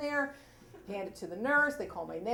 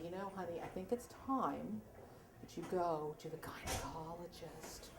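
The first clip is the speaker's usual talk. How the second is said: sweet mother; 7 semitones lower, 14 dB quieter than usual. Retold or imitated. Mother tells her daughter it's time to go, sweetly and softly. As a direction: imitate a gentle mom broaching it quietly. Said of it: "my mother was the sweetest person".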